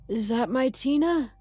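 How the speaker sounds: put-on tired old man. A narrator's reading. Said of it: "He sounds super tired".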